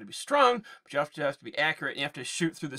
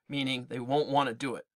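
plain reading voice

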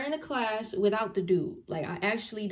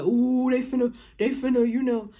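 loud, hyped onlooker imitation; egging-on classmates; 2 semitones higher, 6 dB louder than usual. Classroom watching two girls bicker, mock excitement. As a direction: mimic a crowd stirring up a fight.

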